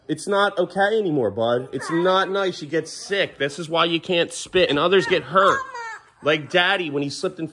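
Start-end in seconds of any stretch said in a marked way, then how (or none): none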